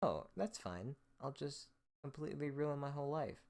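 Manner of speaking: a hint of a careless voice, sarcastic; mock nonchalance. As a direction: shrug it off in a sarcastic singsong. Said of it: "You don't just".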